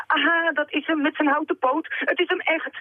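high-pitched exclamation of delight; thrilled story-character voice; delighted cry. A narrator's reading. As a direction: exclaim it overjoyed, pitched well up. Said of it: "riep meneer Panini verrukt".

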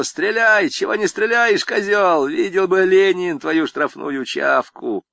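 high, loud defiant yell at the prosecutor; retold yell. Convict yells at the prosecutor in court, loud and defiant. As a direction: hurl it at him, daring him to shoot. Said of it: "кричу".

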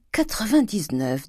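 high, slow savouring; pleased relish. A narrator's reading. Grandet savouring the bond price at the door, pleased relish. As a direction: draw out the figure with relish.

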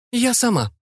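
high, haughty declaration; high-voiced proud refusal. A narrator's reading. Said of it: "гордо сказала женщина".

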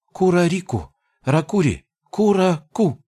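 high, eager goblin gibberish; a goblin pleading with hope; hopeful goblin. Acted. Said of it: "с надеждой в голосе объяснил".

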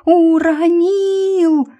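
long, high, loud yell; yelling at full force. A narrator's reading. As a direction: bellow it out at the top of your lungs. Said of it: "проорала, что есть мочи". (орать что есть мочи)

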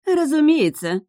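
high-pitched, scornful retort; haughty snort. A narrator's reading. Witch answering the heroine's question, haughty snort. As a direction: toss it off as beneath you. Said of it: "высокомерно фыркнула ведьма".

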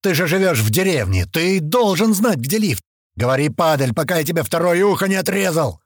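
loud, hammering threats; furious interrogation. A narrator's reading. Knifeman threatening the captive's second ear, furious shouting. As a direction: bellow demands at a cornered captive.

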